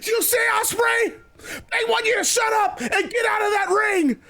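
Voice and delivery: high-pitched indignant wrestler; Jericho, indignant. Acted. Wrestler turns the crowd's chant on rival, high and indignant. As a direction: throw it at your rival in a high voice.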